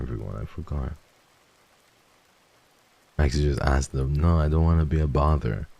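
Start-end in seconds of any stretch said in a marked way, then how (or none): none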